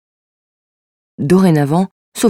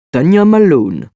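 hammed-up Russian accent, drawn out; 4 dB louder, slower than usual. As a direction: pronounce the name with a theatrical Russian accent. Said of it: "puis l'accent russe".

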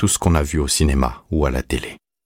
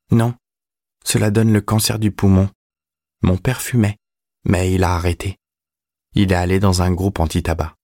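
put-on higher boyish voice, matter-of-fact; teenage boy, declining; 4 semitones higher than usual. A narrator's reading. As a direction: explain it like a well-taught schoolboy. (voix aiguë de garçon, sûr de lui)

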